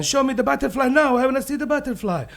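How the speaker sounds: high, urgent insisting; impatient demand. Acted. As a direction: demand it like an overexcited kid. Bear demands to see butterfly.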